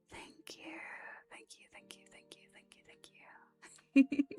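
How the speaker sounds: tiny, very soft whisper; barely audible ASMR gratitude; whispered thanks. Shown as a demonstration. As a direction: whisper repeated thank-yous right into the mic. Models thanks for gifted membership.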